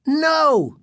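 high, contained narrator's scream. A narrator's reading. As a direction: cry out high without full volume. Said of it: "Greg screamed".

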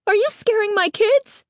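high, shrill accusing question; shrill, outraged demand; shrill and angry. A narrator's reading. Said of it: "her voice shrill and angry".

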